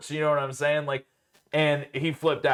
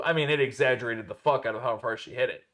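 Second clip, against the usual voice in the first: usual speaking voice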